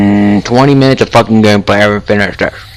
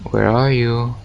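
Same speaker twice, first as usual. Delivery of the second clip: usual speaking voice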